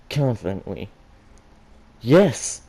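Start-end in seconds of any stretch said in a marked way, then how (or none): 2.0-2.6 excited yes. Acted. Blake accepting eagerly.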